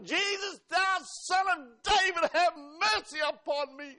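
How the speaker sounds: slow, loud desperate cry for mercy. Retold or imitated. Preacher voicing blind Bartimaeus crying out to Jesus, loud shout. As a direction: call out over the crowd, begging. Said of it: "And he cried even louder".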